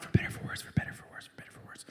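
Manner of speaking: fast whispered vow, fading away; whispered mantra. Retold or imitated. Husband steadying himself with wedding vows, whispered. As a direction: whisper it to yourself like a coping chant.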